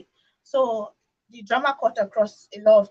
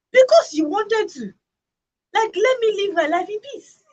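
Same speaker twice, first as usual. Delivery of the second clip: exasperated retort; 10 semitones higher, 7 dB louder than usual. Retold or imitated. Retort to pushy aunties.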